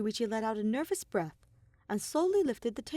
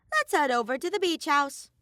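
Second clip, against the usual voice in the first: high, loud cartoon bear voice; louder, higher bratty bear mascot; Monokuma voice; 8 semitones higher, 6 dB louder than usual.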